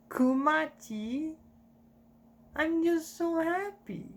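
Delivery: slow high voice of a fading Ginny; Ginny's voice. Acted. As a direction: speak like a happy dying woman. Dying Ginny's voice.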